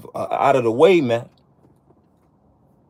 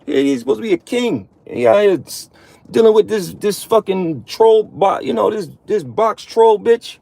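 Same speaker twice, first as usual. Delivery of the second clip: high, put-on voice of a disapproving Lord; mock divine disgust, pitched high; the Lord looking down; 6 semitones higher than usual. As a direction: play God shaking his head at you. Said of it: "The Lord be looking down on us like".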